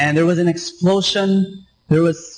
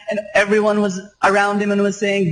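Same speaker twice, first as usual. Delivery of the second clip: ordinary talk, nothing marked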